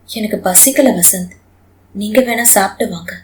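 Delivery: weary wife turning down food; subdued, low voice; tired and quiet. A narrator's reading. Wife refuses lunch after exam.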